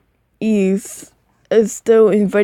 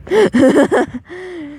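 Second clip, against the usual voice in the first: high laugh; 8 semitones higher than usual. Real, not acted. Laughs at her own reading.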